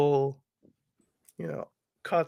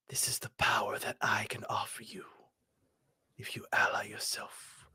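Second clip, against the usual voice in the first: different voice; 6 semitones lower, 8 dB quieter than usual. Acted. Game master voices the blade's answer to a player, whispered. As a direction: whisper it like a tempting unseen power. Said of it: "from a different voice".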